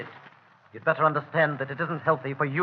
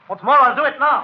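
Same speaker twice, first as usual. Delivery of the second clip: angry threat; 8 semitones higher, 5 dB louder than usual. Acted. Angry threat to call police.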